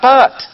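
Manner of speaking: but shouted high and loud; stress on but. Acted. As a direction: punch the word but. Punchline word of the contrast.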